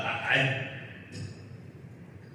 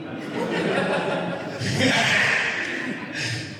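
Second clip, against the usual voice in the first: high breathy laugh at his own story; real laugh; 9 semitones higher than usual. Real, not acted.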